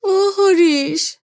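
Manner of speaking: put-on old man wailing for his son; like a helpless child crying out; crying call. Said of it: "কান্নার মধ্যে ডাকলেন".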